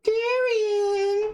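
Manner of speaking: shrill, high crying wail; overacted anime dub. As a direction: sob the name like a bad dub. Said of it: "Hurts my throat to do that".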